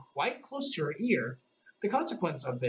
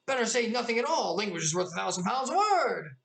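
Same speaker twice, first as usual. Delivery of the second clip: chorus voice; 7 semitones higher than usual. A narrator's reading. Chorus replies.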